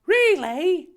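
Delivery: high, excited "Really?"; excited gasp. Acted. Harry thrilled at a dinner invite, high gasp. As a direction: gasp it in delight. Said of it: "Harry gasped excitedly".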